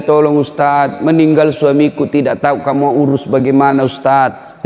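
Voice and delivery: usual speaking voice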